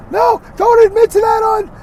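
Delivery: absurd high falsetto outburst; hammed-up mock panic, very high; panicky, overreactive voice. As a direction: cut in as the hysterical worrier. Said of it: "that panicky, overreactive voice".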